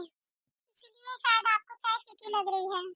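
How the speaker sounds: usual speaking voice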